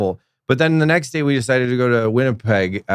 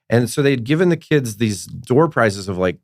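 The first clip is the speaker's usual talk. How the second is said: ordinary talk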